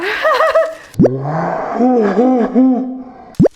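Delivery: loud laugh through the burn; pained laugh. Real, not acted. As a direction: laugh out the pain of the spice. Pained laugh after hot chip.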